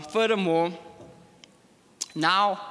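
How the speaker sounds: ordinary talk, nothing marked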